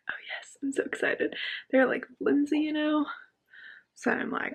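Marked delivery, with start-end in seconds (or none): 0.1-1.3 giddy whisper; whispered excitement. Real, not acted. Vlogger to viewers about new sunglasses, excited whisper. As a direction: whisper it like a thrilled secret.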